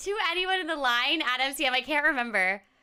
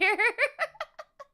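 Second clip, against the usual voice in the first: a bright burst of giggling; high laugh; 9 semitones higher than usual. Real, not acted. Streamer giggling at her kids' meal story, laughing. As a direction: giggle at your own story.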